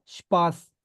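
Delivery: स्पास said with a sh sound, shpas; German sp as shp. Shown as a demonstration. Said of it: "एस पी शप की आवाज आती है". (स्पास को शपास बोलें)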